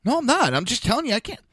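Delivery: high-pitched, exasperated denial, lightly acted; indignant protest. Retelling arguing with the county lady, exasperated. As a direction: insist you're not yelling, voice jumping up. Retold argument with the clerk.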